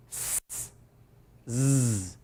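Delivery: "S" hissed unvoiced, then "z" buzzed; phoneme demo. Shown as a demonstration. A teacher modelling sounds for the class, hissed S then buzzed z. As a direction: hiss the S then buzz the z. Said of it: "I'll say it, then you say it".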